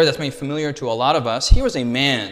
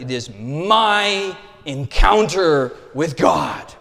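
slow, louder climb toward the climax; building preacher fervour; 4 semitones higher, 7 dB louder, slower than usual. Acted. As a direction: swell into the main point. Sermon climax on personal faith.

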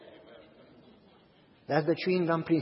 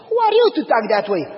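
louder, higher indignant snap; angry retort; 6 semitones higher, 7 dB louder than usual. Retold or imitated. Landlord snapping back at the pastor, louder and higher. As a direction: put the pastor in his place.

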